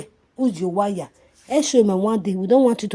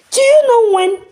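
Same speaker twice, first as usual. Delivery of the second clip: loud, very high outraged cry; high shout; 12 dB louder than usual. Real, not acted. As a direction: burst out shrieking the question. Rant peak.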